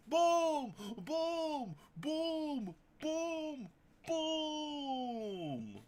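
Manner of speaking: high, loud sung booms; five big booms, high and loud. Acted. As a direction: belt out each boom. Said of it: "five big booms".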